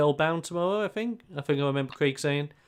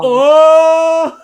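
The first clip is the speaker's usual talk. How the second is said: loud, high burst of delight; delighted yelp; 14 dB louder than usual. Real, not acted. Player yelps loudly, delighted at the extra large joke. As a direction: explode at the pun. Reaction to XL pun.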